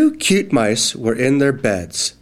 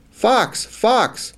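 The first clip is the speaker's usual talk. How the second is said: yelled; 10 semitones higher than usual. Acted. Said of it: "yelled the mice".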